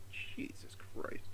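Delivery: exasperated whisper under breath; whispered curse. Real, not acted. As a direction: mutter it, fed up, barely voiced. Annoyed aside after phone interruption.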